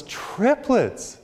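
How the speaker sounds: very high-pitched, incredulous delight; overjoyed new father. Acted. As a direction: squeal it like a comically thrilled dad. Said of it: "he's all happy and everything".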